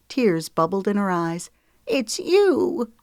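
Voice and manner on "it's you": hushed, choked-up recognition; breathed. Acted. Grandma seeing Luna on the screen, breathed. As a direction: let it slip out on a breath. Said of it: "she breathed".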